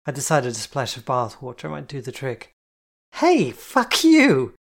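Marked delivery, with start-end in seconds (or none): 3.1-4.5 shrill outraged scream, drawn out. A narrator's reading. Friend soaked with bath water screams in outrage. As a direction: scream it at the splash. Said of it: "she screamed".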